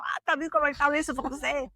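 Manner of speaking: a snide local sneering at the foreigner; mocking Italian shopkeeper. Italians mocking a French speaker behind his back, quick snide aside. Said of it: "Faut que je te dise en disant".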